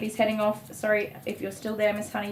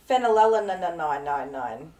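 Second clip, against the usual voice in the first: laughing, drawn out; 3 semitones lower, 7 dB louder, much slower than usual. Shown as a demonstration. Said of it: "Can you read that?".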